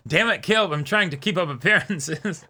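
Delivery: words spilling out through real laughter; laughing. Real, not acted. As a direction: giggle your way through the excuse. Laughing at own slip.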